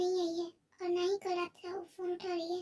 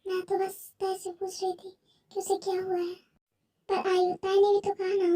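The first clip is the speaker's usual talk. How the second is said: ordinary delivery, nothing marked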